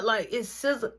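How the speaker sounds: ordinary talk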